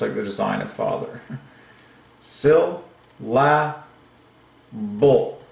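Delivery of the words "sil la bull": "Sil, la, bull" in raised, widely spaced beats; syllable by syllable. Shown as a demonstration. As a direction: sound out "Sil", "la", "bull" one at a time, stepping down. Sounding out syllable piece by piece.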